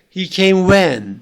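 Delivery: slow echo question, "when" loud and stressed; stressed question word. Shown as a demonstration. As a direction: stretch and push the final "when". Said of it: "yüksek vurguyla söylenmeli".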